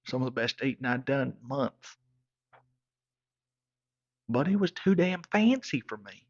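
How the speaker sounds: normal talking voice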